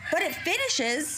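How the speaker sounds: normal talking voice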